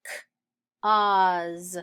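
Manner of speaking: clicked "k", pause, then long "oz"; sounded out. Shown as a demonstration. Teacher sounding out cause for pupils, sounded out. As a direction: separate k from the rest.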